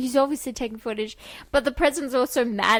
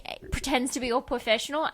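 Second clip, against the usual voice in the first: ordinary talk, nothing marked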